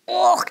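high, loud noise of disgust; loud "ugh" of revulsion; disgusted splutter. A narrator's reading. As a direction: splutter at a vile taste. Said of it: "John spluttered".

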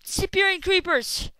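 indignant shout at the game; outraged exclaim. Real, not acted. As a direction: call it out in disbelief. Outraged at vanishing creepers.

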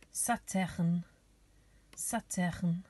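"Saturn" said the French way, sa-TÜRN; French pronunciation. Shown as a demonstration. Teacher giving children the French planet name, "Saturn" pronounced sa-TÜRN. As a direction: model "Saturn" with French vowels and r. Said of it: "names of the planets in French".

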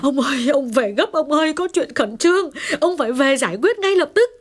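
high, distraught wailing plea; tearful. Acted. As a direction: plead urgently, close to tears. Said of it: "mếu máo nói".

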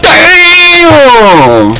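very high, long-held exclamation falling away; wailing outcry. Real, not acted. Player stunned by a move in the fight, wailing. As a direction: wail it out high.

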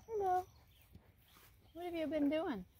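high, cooing voice for the horse; pet talk. Real, not acted.

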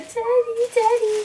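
put-on high, loud childish call of Daddy; imitating little sisters. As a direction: mimic a little kid whining for dad. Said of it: "My little sisters are literally just going".

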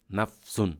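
নাফসুন ending in "-un"; rofa ending. Shown as a demonstration. Teacher showing the rofa form, নাফসুন with "-un". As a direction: say নাফসুন in two parts, closing on "sun". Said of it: "যদি রফা হয়, কিভাবে হবে".

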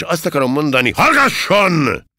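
high, loud, building to a shout; exasperated loud command; angry silencing. A narrator's reading. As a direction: cut the recruit off with a yelled order. Officer silencing the insolent recruit.

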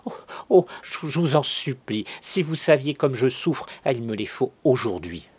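high, loud, desperate plea; tearful stammering. A narrator's reading. Cousin harried by an irresistible order, begging in stammering anguish. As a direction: stammer and beg as if weeping. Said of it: "Elle pleurait, bégayait".

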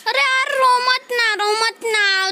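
high wail easing down; whiny, imploring cry; near-tears pleading. Pretend. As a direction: beg as if about to cry yourself. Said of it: "मुझे भी रोना आ जाएगा".

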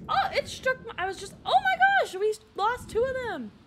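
high, loud cry of dismay; alarmed outburst. Real, not acted. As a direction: blurt it out in shock, loud and high. Reacting to sudden disaster in game.

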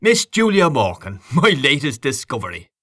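high showman's announcement; grand, playful showman patter; showman introduction. A narrator's reading. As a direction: present a prodigy to the crowd. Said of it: "in the manner of a showman".

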